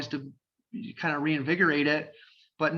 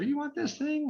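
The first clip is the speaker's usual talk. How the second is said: incredulous; 12 semitones higher than usual. Retold or imitated. Owner voiced in retold call.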